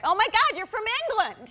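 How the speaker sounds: high, loud excited exclamation; gushing fan. Retold or imitated. Coffee-shop fan guessing where the actress is from, gushing. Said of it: "And she's like".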